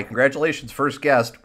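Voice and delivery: ordinary talk, nothing marked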